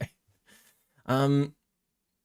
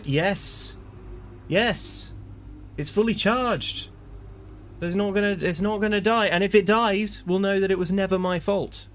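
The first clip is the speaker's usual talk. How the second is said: high mock insistence; 8 semitones higher than usual. Defending controller to chat.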